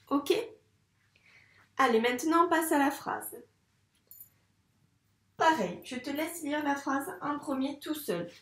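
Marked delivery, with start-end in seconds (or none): none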